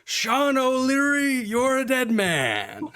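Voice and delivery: high, slow, booming threat shouted across the street; a furious bellow from down the alley; loud bellowing voice. Acted. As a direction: bellow it like an enforcer calling out his mark. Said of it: "You hear a very loud, bellowing voice".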